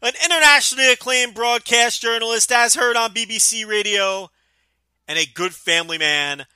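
announcer intro. Acted. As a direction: boom it like a ring announcer. Bombastic self-introduction.

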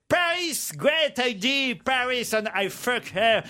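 put-on slow, drawn-out franglais with a thick English accent; mock an English toff stumbling over French; hammed-up English accent. English aristocrat answering his friend's advice, in a hammy English accent. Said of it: "Il prend l'accent de Laurel et Hardy".

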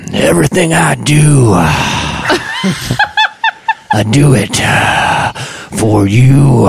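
breathy rock ballad singing; [name] impression. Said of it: "[name] cover".

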